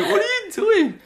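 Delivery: very high, loud squealed protest; alarmed outcry. Retold or imitated. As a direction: squeal it in comic disbelief. Acted outcry at being slapped.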